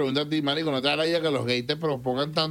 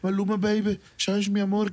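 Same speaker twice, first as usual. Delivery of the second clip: higher, hammed-up Colombian paisa accent; mock reggaeton Colombian accent, higher; 7 semitones higher than usual. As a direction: lay the Colombian accent on thick, pitched up. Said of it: "los colombianos más bien la acentúan demasiado".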